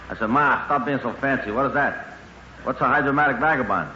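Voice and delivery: usual speaking voice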